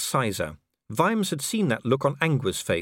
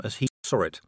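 ordinary delivery, nothing marked

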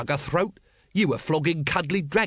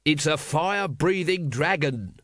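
mild mock scream, higher and slow; 4 semitones higher, slower than usual. A narrator's reading. Vimes warning crowd of the dragon, mildly screamed. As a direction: stretch it out in understated exasperation. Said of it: "screamed Vimes".

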